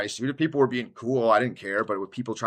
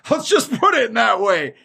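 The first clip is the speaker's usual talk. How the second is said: laughing loudly; 11 semitones higher, 6 dB louder than usual. Real, not acted. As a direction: burst out laughing, higher and louder. Laughing at his own joke.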